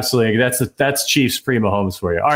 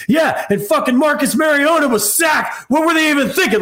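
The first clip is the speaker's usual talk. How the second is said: sudden high shout about Mariota; random scream. Retold or imitated. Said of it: "I'll just randomly scream".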